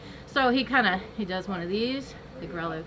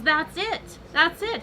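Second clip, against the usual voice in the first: acted sudden realization; 7 semitones higher, 3 dB louder, slower than usual. Doctor suddenly finds the cause.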